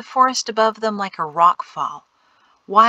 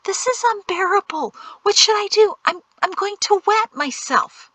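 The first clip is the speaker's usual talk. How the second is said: high-pitched, desperate whining; whimpering; 12 semitones higher than usual. A narrator's reading. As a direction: wail it, about to burst. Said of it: "Jatesy whimpered".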